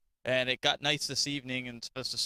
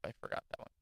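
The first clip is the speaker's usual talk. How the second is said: whispered aside; 6 semitones lower, 13 dB quieter, much faster than usual. Retold or imitated. Retold panic over show notes.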